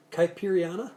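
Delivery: ordinary talk, nothing marked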